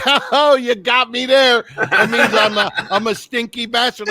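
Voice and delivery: high, mock-delighted concession through laughter; good-sport laughing. Roasted man taking the joke well, laughing good-sport.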